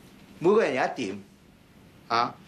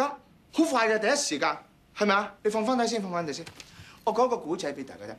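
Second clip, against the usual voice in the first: ordinary talk, nothing marked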